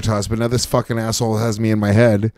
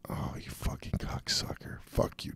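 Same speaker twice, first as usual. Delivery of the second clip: hissed whisper of fury; seething whisper; whispered cursing; 7 semitones lower, 16 dB quieter than usual. Real, not acted. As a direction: curse under the breath.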